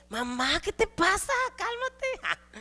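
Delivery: put-on high, exasperated young voice; exasperated child. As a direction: roll your eyes at an overreacting mom. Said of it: "Se pone uno así".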